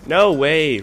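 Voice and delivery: loud cry of disbelief; disbelieving shout. Real, not acted. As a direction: yell it at the screen. Killed again in game.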